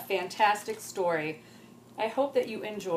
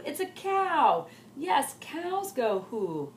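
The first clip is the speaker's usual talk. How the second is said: slow; 6 semitones higher, slower than usual. Acted. Girl reasoning slowly.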